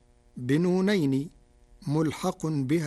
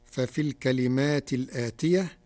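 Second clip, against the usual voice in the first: ordinary talk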